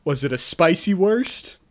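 high, loud comic exasperation; worked-up mock outrage; incredulous rant. Real, not acted. Comic outrage at the sausage detail.

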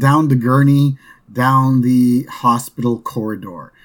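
normal talking voice

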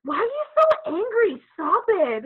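high, loud mock-parent complaint; scolding parent. Parents reacting to an excited kid, mock scolding. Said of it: "and they're like".

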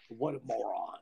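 low, soft imitated aside; retold hot-mic grumble; under his breath. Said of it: "where under his breath".

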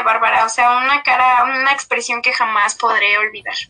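normal talking voice

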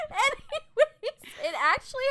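slow high laughing anyways. Real, not acted. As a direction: say it still laughing. Laughing through word.

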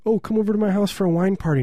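high, quick put-on invitation; imitated German host. Traveller inviting Germans to a party, high mock voice. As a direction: invite them over in a mock voice. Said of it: "German people, number one, are kinky".

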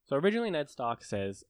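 ordinary talk, nothing marked

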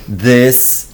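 ordinary talk, nothing marked

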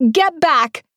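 high, sharp scream at the intruder; screaming woman. A narrator's reading. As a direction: yell it to drive him back. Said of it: "the woman screamed".

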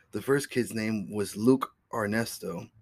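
usual speaking voice

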